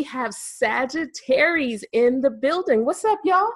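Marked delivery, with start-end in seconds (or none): none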